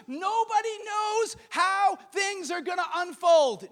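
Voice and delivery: high, loud, impassioned preacher; full-force preaching. Real, not acted. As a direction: preach it at a shout, stretching key words.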